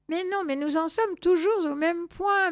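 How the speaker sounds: high-pitched, frustrated cat; meowing protest. A narrator's reading. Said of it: "miaula Zorbas".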